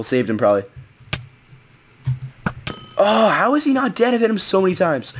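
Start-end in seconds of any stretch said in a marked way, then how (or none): 3.0-5.1 frustrated disbelief. Real, not acted. Frustrated enemy won't die.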